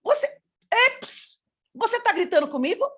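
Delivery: high, startled telling-off; high-pitched mock-indignant question; indignant challenge. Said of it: "a minha irmã falou".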